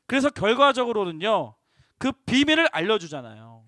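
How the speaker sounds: ordinary delivery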